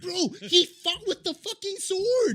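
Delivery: high, drawn-out, laughing exclamation; real excited outburst. Real, not acted. Fan raving to co-host about a horse's swordfight, excited outburst.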